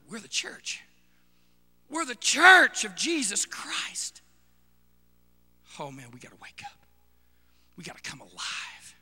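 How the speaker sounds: whispering to the congregation that they must wake; hushed urgency. Real, not acted. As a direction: drop to a whisper to grip them. Whispered dramatic drop.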